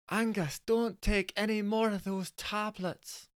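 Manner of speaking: high soft pleading wife; high, slow wifely plea; wife's voice. Acted.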